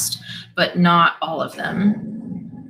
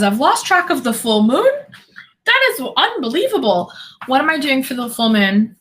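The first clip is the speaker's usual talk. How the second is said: flustered disbelief; 6 semitones higher than usual. Real, not acted. Panics about forgotten full moon.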